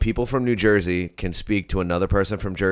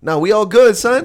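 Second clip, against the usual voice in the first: high, louder brush-off; mock confrontational; 12 semitones higher, 7 dB louder than usual. Co-host acts out a tense exchange, mock confrontational. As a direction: push back high and loud, play-acting.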